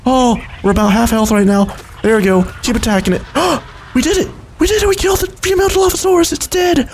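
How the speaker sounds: very high, fast excited squeals; shrieking jackpot winner. Real, not acted. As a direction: squeal like you just won big. Winning a fight, thrilled.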